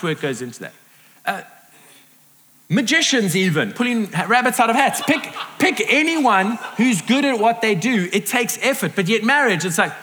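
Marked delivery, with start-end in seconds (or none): none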